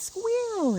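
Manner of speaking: high piercing mock squeal; imitated squeal at top pitch. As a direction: let out a shrill squeal far up high. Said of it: "And it was".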